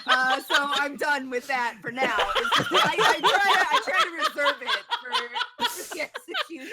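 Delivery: breaking up laughing mid-sentence. Real, not acted. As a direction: laugh your way through the excuse. Laughing while explaining.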